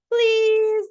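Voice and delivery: high, loud pleading please; pigeon begging. Acted. Pigeon begging the reader to drive, high loud plea. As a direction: beg like a desperate bird.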